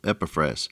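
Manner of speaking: Epaphras with the stress on the first syllable, EP-a-phras; accent on first syllable. Shown as a demonstration. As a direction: put the weight on EP in Epaphras. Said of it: "the accent on the first syllable".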